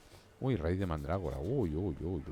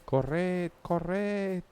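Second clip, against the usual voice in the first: very high, louder teasing chase call; high playful taunt; 13 semitones higher, 4 dB louder than usual. Pretend. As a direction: shriek it like a kid chasing friends.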